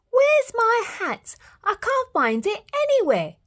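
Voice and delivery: silly chick. A narrator's reading. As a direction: fret like a daft little chick. Silly chick hunts his hat.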